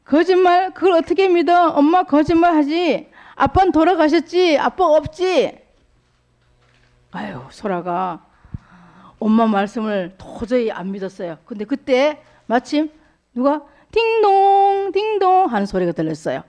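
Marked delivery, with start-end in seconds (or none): 0.1-5.6 put-on high-pitched, protesting little girl; little girl protesting to mother, high and insistent; disbelieving child. Sora refuses to believe mother. 13.9-14.9 high, slow sung ding-dong; mimicking a doorbell for children, high and slow. Imitating the doorbell.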